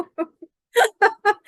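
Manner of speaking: word squeezed out through a high real laugh. Real, not acted.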